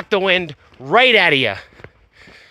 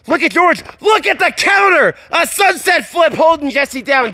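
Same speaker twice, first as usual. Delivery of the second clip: excited shout; 5 semitones higher than usual. Real, not acted. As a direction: yell out the sudden counter. Commentator calls a counter.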